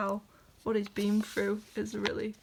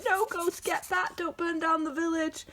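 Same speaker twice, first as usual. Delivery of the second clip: much higher, urgent yelp; mock alarmed plea; 9 semitones higher than usual. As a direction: cry out to stop a friend. [name] shouts at Ghost.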